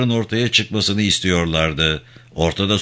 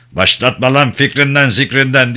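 loud, higher-pitched outburst; angry shout; 4 semitones higher, 5 dB louder than usual. A narrator's reading. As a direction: yell it at the prisoner in anger. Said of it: "diye bağırdı".

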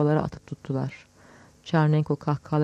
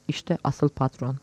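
ordinary delivery

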